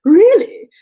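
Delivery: high, loud mock surprise; affected astonishment. A narrator's reading. Man teasing his dance partner about her answer, affected astonishment. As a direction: feign astonishment with a big jump in pitch. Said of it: "with affected astonishment".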